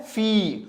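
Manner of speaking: 'फी' held on a long ee; long sustained ee in 'फी'; drawn-out vowel. Shown as a demonstration. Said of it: "इन sound को हम खींच रहे हैं".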